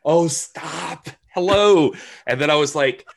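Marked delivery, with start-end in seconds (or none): 0.0-1.9 flirty gushing. Retold or imitated. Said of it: "And she's like".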